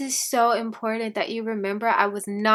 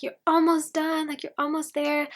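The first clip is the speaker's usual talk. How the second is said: higher, eager mock advisor voice; imitating excited advisor; 6 semitones higher than usual. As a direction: play the thrilled advisor cheering her on. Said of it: "she was so excited".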